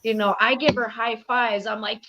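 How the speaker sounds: usual speaking voice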